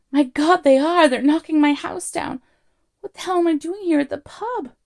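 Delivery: loud, high-pitched alarm; panicked outburst. A narrator's reading. Arthur at the pub window seeing his house wrecked, panicking. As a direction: cry out in horrified realisation.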